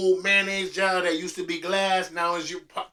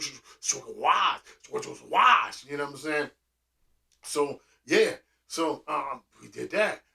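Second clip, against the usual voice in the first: normal talking voice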